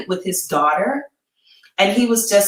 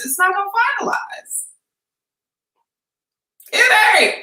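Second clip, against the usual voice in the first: emphatic; 12 semitones higher than usual. Real, not acted. Insisting, voice rising high.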